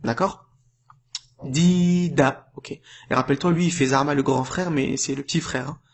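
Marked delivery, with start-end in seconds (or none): 1.4-2.5 "Dida" with long i, short final a; long then short. Shown as a demonstration. Teacher rereading the word, "Dida" long then short. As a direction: hold the "Di" of "Dida", keep "da" brief. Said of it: "C'est court, hein".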